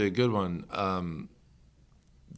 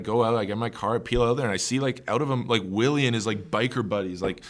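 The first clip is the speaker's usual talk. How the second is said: ordinary talk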